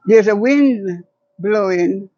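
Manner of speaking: measured, rhythmic poetry recital with long pauses; poem reading. Acted. Poet reads her poem to the interviewer, measured and solemn. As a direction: read it like a hopeful vision of Africa. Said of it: "So can you share one of your poems here?".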